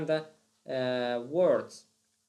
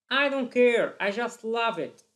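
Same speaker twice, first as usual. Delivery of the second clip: loud, high, emphatic outburst; defiant insistence; 6 semitones higher, 5 dB louder than usual. Acted. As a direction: blurt it out with acted defiance. Acts out deciding by feelings.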